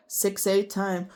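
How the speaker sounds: normal talking voice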